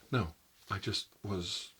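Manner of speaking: normal talking voice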